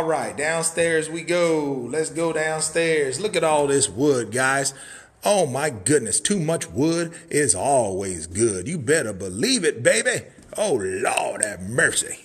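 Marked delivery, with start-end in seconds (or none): none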